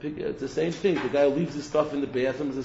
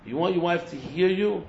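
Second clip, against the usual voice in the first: ordinary delivery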